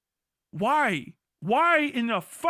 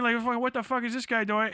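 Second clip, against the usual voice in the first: normal talking voice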